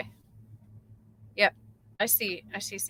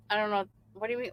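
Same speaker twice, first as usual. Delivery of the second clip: usual speaking voice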